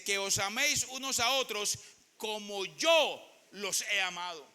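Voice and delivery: slow, deliberate scripture reading; solemn slow quote. Shown as a demonstration. Preacher quoting Jesus's command to the church, slow and solemn. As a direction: lay each word down slowly.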